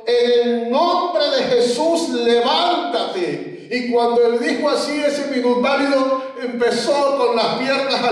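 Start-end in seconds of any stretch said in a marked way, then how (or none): 0.0-3.7 slow, drawn-out solemn command; slow, declaiming healing command. Retold or imitated. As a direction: stretch it out like a blessing. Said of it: "le dice al minusválido".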